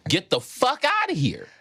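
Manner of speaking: high-pitched mock disbelief; incredulous dismissal. Pupil rejecting the teacher's explanation, incredulous.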